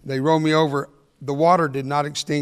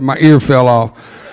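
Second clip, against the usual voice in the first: sheepish confession; 3 semitones lower, 11 dB louder, slower than usual. Retold or imitated. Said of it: "I had to fess up".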